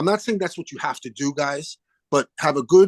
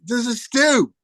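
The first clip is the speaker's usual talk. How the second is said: put-on Australian accent; 9 semitones higher, slower than usual. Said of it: "this wonderful guy from Australia gets on the phone".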